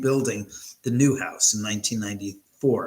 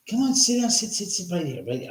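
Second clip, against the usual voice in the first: coaxing the dog; 7 semitones higher than usual. Real, not acted. Coaxing the old dog to sit.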